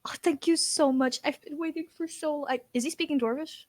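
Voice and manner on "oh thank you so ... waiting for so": higher, whispery mock weeping; fake tearful thanks. Noblewoman thanking the dwarf host, fake tearful. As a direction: gush thanks through put-on tears. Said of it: "wiping fake tears off".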